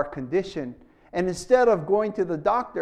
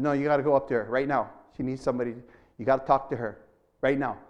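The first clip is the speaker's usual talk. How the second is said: lower, calm commanding inner voice; voice of the Holy Spirit; 7 semitones lower, much faster than usual. Retold or imitated. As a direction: speak as a low, firm voice from within. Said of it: "He said".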